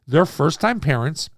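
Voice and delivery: ordinary delivery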